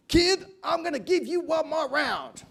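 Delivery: high, loud, slow mock-American referee; American accent. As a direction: put on a hammed-up American accent. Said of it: "That's my American accent".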